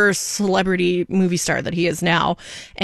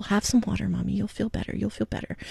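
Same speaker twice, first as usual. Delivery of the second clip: imitated little one, very softly soothing; hushed, tender reassurance; soft comforting child; 9 dB quieter than usual. Daughter comforts her crying mommy with water, soft imitated soothing.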